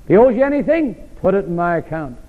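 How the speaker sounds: high cries on each if, dropping on put it in my account; rising refrain. Acted. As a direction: build the refrain call and answer.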